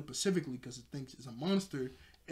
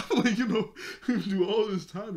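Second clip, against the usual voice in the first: laughing; 6 semitones higher, 8 dB louder than usual. Real, not acted. Laughing recalling childhood reaction.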